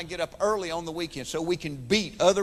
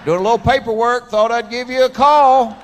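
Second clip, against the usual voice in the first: mock phone call; 4 semitones higher, 10 dB louder than usual. Man on the toilet calling George, loud and hammed-up. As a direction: boom it out like a proud man on the phone. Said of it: "It's like".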